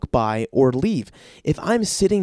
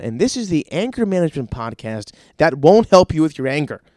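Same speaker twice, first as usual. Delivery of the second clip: normal talking voice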